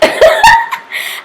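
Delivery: high-pitched burst of laughter. Real, not acted. Vlogger laughing at her love of nose highlight. Laughs after saying she loves it.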